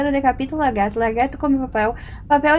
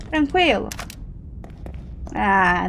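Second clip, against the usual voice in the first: ordinary delivery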